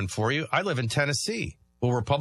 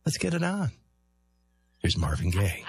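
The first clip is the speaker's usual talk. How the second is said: late-night love-songs DJ; 2 semitones lower than usual. Pretend. Host pretending to introduce [name], late-night DJ voice.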